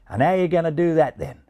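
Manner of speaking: high, casual Aussie question; Australian pigeon wanting details; Australian accent. A narrator's reading. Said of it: "said the Australian pigeon".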